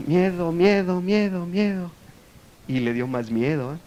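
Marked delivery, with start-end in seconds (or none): 0.1-1.9 repeated word fading softer; cave echo. Retold or imitated. Cave echoing the man's fear, fading imitation. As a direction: imitate an echo dying away. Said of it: "la cueva contestó el eco".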